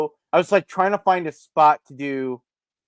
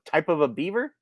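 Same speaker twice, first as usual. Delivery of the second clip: usual speaking voice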